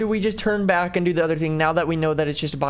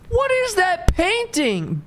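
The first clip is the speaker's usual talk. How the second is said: surprised; 11 semitones higher, slower than usual. Real, not acted. Streamer reacts to strange painting.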